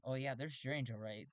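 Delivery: ordinary delivery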